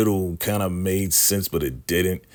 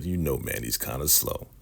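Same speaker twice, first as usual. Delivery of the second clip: normal talking voice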